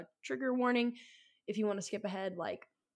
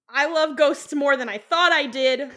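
high, loud whoop of enthusiasm; hyped-up cheer, louder and higher; excited shout; 4 semitones higher, 12 dB louder, slower than usual. Retold or imitated. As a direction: build to a drawn-out triumphant yell. Acts out excited reaction to ghost.

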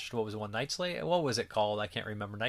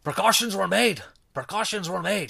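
high hammed-up Scottish accent, louder; mock Scottish accent; 9 semitones higher, 4 dB louder than usual. Imitating Vic Morrow's Quint-style Scottish accent, hammed up. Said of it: "He has this Scottish accent".

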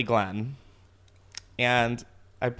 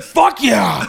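loud, high hammed-up cheer; mock eager bro; 7 semitones higher, 7 dB louder than usual. Imagined driver agreeing to hook up, loud mock cheer. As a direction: yell it like a dumb jock agreeing. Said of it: "He's like".

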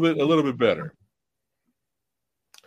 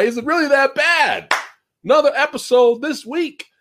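higher catchphrase, hammed up a bit; 9 semitones higher than usual. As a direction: toss out his own catchphrase in a put-on voice. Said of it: "remix version".

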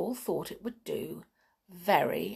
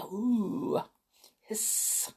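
breathy snake hiss; 2 semitones higher, 4 dB quieter than usual. Pretend. Snake sound after Snaky Day.

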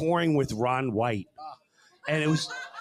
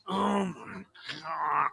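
slow, soft, whimpering groan; hammed-up anguish over the pitch; mock despair; 7 dB quieter, much slower than usual. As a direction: moan it like you're in pain. Dreading the magnet sales pitch.

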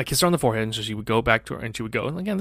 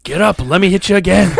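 inner rage; 6 semitones higher, 7 dB louder than usual. Retold or imitated. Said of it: "in my head, I'm like".

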